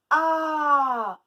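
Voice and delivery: AH very loud and held long; stretched vowel. Shown as a demonstration. Teacher letting pupils hear the A, stretched vowel. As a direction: sustain Ah as a strong open vowel. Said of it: "Où est-ce qu'on entend le A?".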